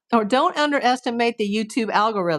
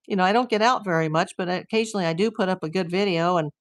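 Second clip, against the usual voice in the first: normal talking voice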